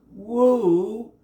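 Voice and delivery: high clear owl hoot. A narrator's reading. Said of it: "said a high, clear voice".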